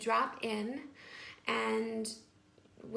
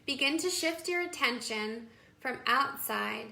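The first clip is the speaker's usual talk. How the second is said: guided meditation. Acted. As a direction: guide them into calm, phrase by unhurried phrase.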